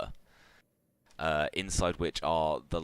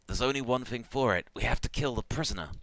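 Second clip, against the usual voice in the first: higher-pitched engineer voice with urgency; desperate hobgoblin; 8 semitones higher, 3 dB louder, faster than usual. Acted. Hobgoblin decides on the emergency measure, urgent and high. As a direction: urge the last resort in a raised pitch. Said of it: "the hobgoblin looks at it and says".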